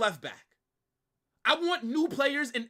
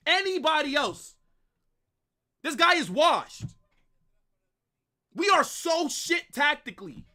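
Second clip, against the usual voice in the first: raised, higher fed-up venting; angry rant; 6 semitones higher, 4 dB louder than usual. Real, not acted. Fan venting about his washed coach, raised angry voice. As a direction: let the frustration spill out, louder.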